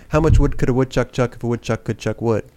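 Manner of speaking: rapid-fire tongue twister; fast. Shown as a demonstration. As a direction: fire it off quickly. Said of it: "Slow it down for him".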